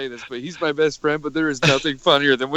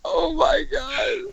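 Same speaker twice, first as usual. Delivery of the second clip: crying with laughter; 3 dB quieter, much slower than usual. Real, not acted. Laughing hard at outburst.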